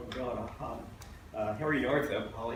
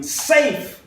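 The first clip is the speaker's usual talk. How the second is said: loud, high-pitched umpire call; umpire's bark; 15 dB louder than usual. Acted. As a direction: bark it out across the ballpark. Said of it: "Umpire barking".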